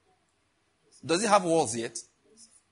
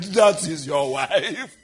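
loud and drawn out, with a laugh; playful booming voice of the Lord announcing a wife; booming divine voice; 7 dB louder, much slower than usual. Acted. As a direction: boom it out slowly like God speaking. God's voice in vision.

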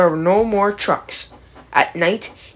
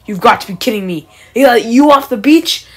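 incredulous; 2 semitones higher, 8 dB louder than usual. Acted. Gordon incredulous at Bulstrode.

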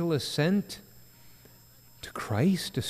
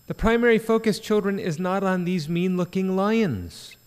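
loud, raised preaching voice, driving each point; full-force preaching; 4 semitones higher, 7 dB louder than usual. Real, not acted. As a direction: proclaim it to the congregation.

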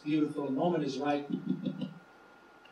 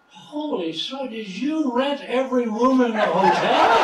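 put-on drunk exclamation; 6 semitones higher, 6 dB louder than usual. Drunk amazed at same man.

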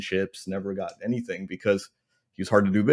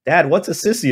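daughter asking; 7 semitones higher, 6 dB louder than usual. Retold or imitated. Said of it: "and she goes".

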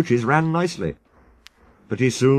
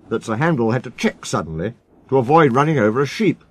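ordinary delivery, nothing marked

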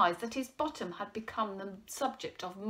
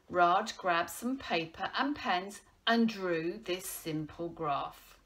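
usual speaking voice

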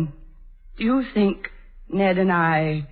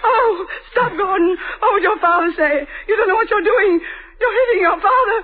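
louder, crying pleas to stop; frantic tearful pleading; 3 semitones higher, 4 dB louder than usual. Acted. Mother crying out to stop son hitting his father, frantic and tearful. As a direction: beg through tears as the blow lands.

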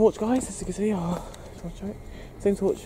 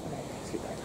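nervous night vlog whisper; 8 dB quieter than usual. Real, not acted. Sneaking forward at night.

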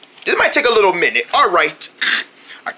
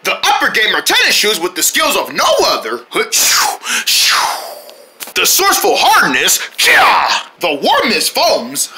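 hyped ad announcer. Acted. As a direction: sell the shoes with hype. Fake sneaker commercial read.

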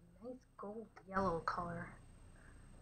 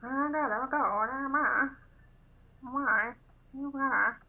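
put-on higher and much louder, old-movie talk; old film voice; 5 semitones higher, 11 dB louder, slower than usual. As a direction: ham it up like an actor in an old film. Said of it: "Why did they talk like that in old films?".

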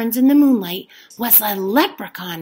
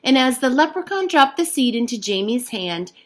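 ordinary delivery

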